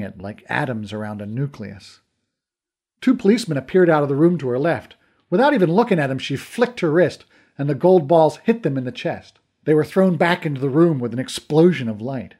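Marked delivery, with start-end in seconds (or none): none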